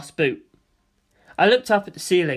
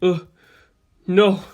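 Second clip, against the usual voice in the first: hoarse plea; 4 dB louder than usual. A narrator's reading. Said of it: "hoarse pleas".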